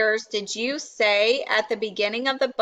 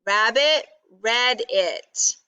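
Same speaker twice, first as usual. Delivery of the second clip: ordinary delivery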